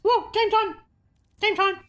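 very high squealed announcement; whooping excitement. Real, not acted. Streamer hyped for game time, high squealing whoop.

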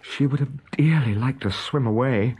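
ordinary delivery, nothing marked

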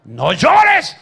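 a father telling his son not to cry; stern parent. Retold or imitated. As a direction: bark it like a strict dad. Parent voiced scolding son.

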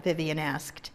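ordinary delivery, nothing marked

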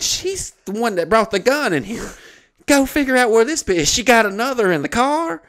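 high-pitched, agitated retort; alarmed protest. Retold or imitated. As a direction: act out urging the cop to go after her. Acted alarmed retort to the cop.

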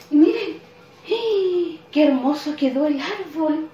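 loud and high, delighted; storyteller showing the children the beautiful tree, thrilled; excited reveal. Acted. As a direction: show the children the tree with a burst of joy.

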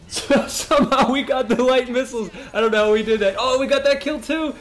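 high, laughing surprise; thrilled disbelief at a lucky kill; excited. Real, not acted.

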